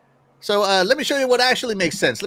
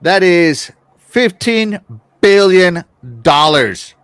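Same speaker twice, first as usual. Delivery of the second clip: fifteen billion dollars spelled out slowly and loudly; slow emphatic; 8 dB louder, much slower than usual. Real, not acted. Emphasizing the huge sum.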